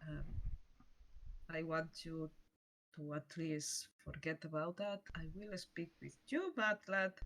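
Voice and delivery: softly, putting the matter aside for later; subdued. Acted. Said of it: "and she's like".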